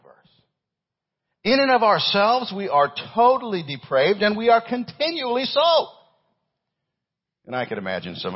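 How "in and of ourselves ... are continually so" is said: loud, high-pitched pulpit cry; full-force preaching. Acted. Preacher declaring human depravity to his church, full-force preaching. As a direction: hammer it out to the congregation.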